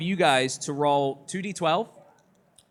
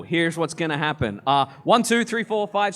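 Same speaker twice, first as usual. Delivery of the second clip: normal talking voice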